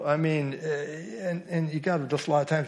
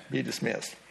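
usual speaking voice

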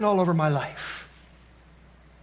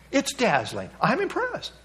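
high, sarcastic I'm impressed then a laughing dismissal; mock impressed; 7 semitones higher than usual. Preacher mocking the idea God is impressed, high and sarcastic. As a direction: feign being impressed, then scoff. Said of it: "I don't think so".

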